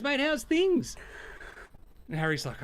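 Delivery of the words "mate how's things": put-on high, bright hail to an old mate; cheery greeting. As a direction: call out high to your old friend. James greets Harry again.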